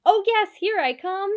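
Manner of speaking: high, loud happy reply; eager cheerful answer; smiling. Acted. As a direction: smile through it. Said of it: "With a big smile".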